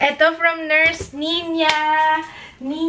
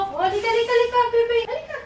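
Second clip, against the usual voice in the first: higher, sing-song coaxing of a little one; coaxing call; 8 semitones higher, much faster than usual. Real, not acted. Coaxing the child to come. (malambing na pagtawag sa bata)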